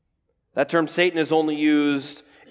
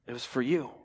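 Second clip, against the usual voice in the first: hushed; 4 semitones lower, 9 dB quieter, faster than usual. Acted. Intimate sermon climax.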